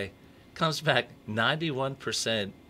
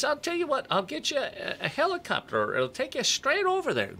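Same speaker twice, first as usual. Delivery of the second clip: leprechaun voice. Acted. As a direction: pitch way up into a comic leprechaun. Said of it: "great leprechaun voice".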